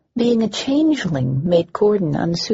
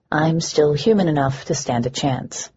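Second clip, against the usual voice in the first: ordinary delivery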